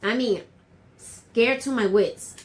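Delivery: usual speaking voice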